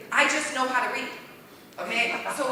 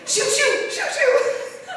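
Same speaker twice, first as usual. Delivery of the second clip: mock scared; 6 dB louder, much slower than usual. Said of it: "you have to be scared and be like".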